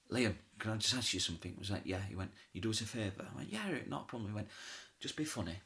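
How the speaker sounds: hushed backstage. Retold or imitated. Whispered side-of-stage exchange retold.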